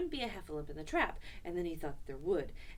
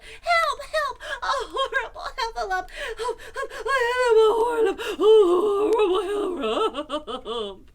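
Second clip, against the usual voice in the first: high squealing wail, growing louder and more garbled; panicked crying out; 14 dB louder than usual. A narrator's reading. Piglet scampers off in fright, shrill loud wailing. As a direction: wail in terror, tripping over the words. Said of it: "still crying out".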